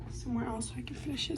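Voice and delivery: rushed unvoiced whisper; fast whisper. Real, not acted. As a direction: whisper quickly before moving away. Whispering the story.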